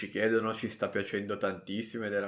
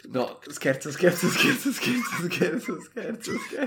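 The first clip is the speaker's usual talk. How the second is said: laughing; 6 semitones higher, slower than usual. Real, not acted. Playful repeated joke.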